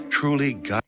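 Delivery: usual speaking voice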